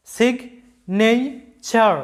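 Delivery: Signature stretched very slowly, every syllable drawn out; slow, careful signature; slow model word. Shown as a demonstration. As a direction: drag out signature so each part is heard. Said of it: "লক্ষ্য করো".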